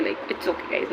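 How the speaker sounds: soft whispered aside, trailing up; whispered reassurance. Real, not acted. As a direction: breathe it out like a secret to yourself. Self-reassuring aside about her look.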